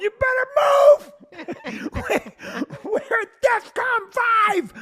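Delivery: high, slow, shouted mock panic; frantic inner alarm voices. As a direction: bellow orders like a sub captain in crisis. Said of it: "They're going".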